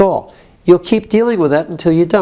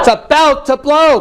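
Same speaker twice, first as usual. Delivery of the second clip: modelled explosive anger; 5 semitones higher than usual. Shown as a demonstration. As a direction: push it out like an eruption. Harder take, urging client on.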